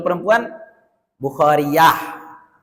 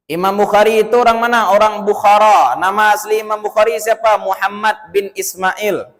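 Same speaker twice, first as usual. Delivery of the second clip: ordinary talk, nothing marked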